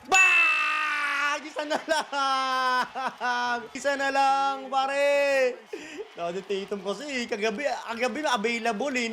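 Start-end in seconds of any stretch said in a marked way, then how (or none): 0.1-5.6 excited celebration. Real, not acted. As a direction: stretch every shout out, very slow and jubilant. Celebrating, one basket to go. (pahabang sigaw sa tuwa)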